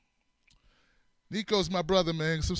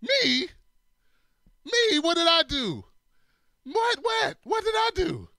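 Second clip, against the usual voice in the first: high, squeaky what did I do; high innocent protest; 10 semitones higher, slower than usual. Retold or imitated. Blamed for his friend's videos, squeaking in high innocent protest. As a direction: play the wrongly blamed kid, pitch way up. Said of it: "I'm like".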